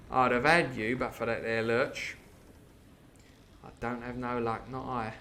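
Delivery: half-hearted Cockney pirate bargaining; attempted Cockney. Wounded coxswain striking terms with Jim, mild Cockney. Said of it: "can't do a very good Cockney voice".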